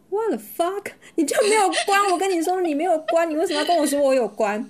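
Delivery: pitched high, mock-furious rant; exasperated outburst. Retold quarrel with partner. (转述吵架，语调拔高的假装气愤)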